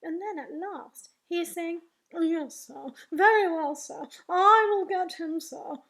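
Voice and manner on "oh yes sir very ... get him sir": funny deferential voice. Acted. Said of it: "his voice goes all funny".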